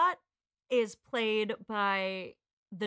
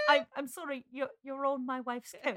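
put-on hesitant, apologetic British accent; 4 semitones higher than usual. Man telling someone they're on his wife's coat, British accent. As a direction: put on an English accent, stammering politely. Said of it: "I do a better accent".

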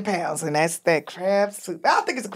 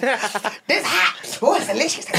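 raised, excited mock Chris Rock voice; a hint of a Chris Rock impression, higher; imitating Chris Rock; 3 semitones higher than usual. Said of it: "he's like".

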